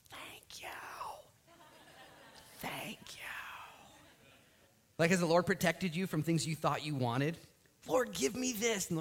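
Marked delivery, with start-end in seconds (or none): 0.1-3.2 breathy whispered thank you, barely there. Retold or imitated. Grateful whisper to God. 7.9-8.9 pleading. Retold or imitated. Acting prayer to God.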